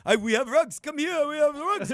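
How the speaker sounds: pushy rug seller. Retold or imitated. Rug seller calling to a tourist, high and pushy. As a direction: lure the tourist in with a high hawker's call.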